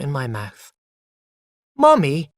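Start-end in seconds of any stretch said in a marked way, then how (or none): 1.7-2.3 put-on a child's bright, loud call for mummy; happy loud child calling. A narrator's reading. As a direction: call out gladly and loudly. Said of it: "I said loudly and happily".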